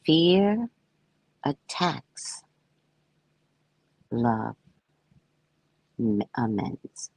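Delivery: slow, hushed reading of the book; solemn poetic reading. Acted. Reading her book to listeners, slow and solemn. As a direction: let each word land, reverent.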